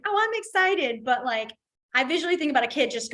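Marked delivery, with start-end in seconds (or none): 0.0-1.0 high, bright "Oh, I'm excited". Shown as a demonstration. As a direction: lift the pitch with real glee. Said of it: "if you're talking about".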